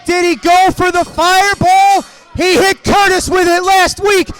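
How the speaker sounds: wrestling announcer yelling over the chaos; excited play-by-play. Acted. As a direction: call the brawl like it's live and chaotic. Live play-by-play of ringside brawl.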